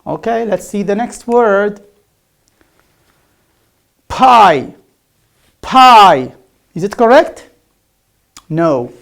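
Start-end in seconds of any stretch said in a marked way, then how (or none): 4.1-6.3 loud "Pie" for buy, puffed P; punchy P in "Pie" where B belongs. Shown as a demonstration. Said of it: "Pie is wrong".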